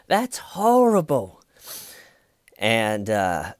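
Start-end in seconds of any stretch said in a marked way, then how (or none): 0.1-1.4 very high, loud, stretched-out outcry; hammed-up appalled scolding; mock horror. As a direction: imitate a scandalised detective at full pitch. Repeats appalled detective impression.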